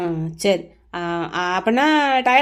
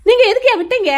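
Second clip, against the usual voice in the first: loud, high-pitched accusation; indignant raised-voice demand; angry blame; 7 semitones higher, 6 dB louder than usual. Acted. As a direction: snap at him in frustration. Angrily blames Valli for allowing it.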